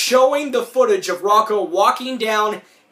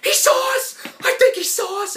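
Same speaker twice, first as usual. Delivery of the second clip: much higher, louder alarmed voice; panicked cartoon yelp; 3 dB louder than usual. Spies realise they're spotted, high alarmed yelp. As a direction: blurt it out as if caught spying. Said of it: "they're like".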